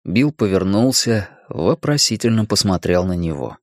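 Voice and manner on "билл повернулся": plain reading voice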